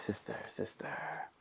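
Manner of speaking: whispered, fading echo; cave echo. A narrator's reading. Echo answering the duck from the cave, whispered. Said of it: "the voice from the cave came back".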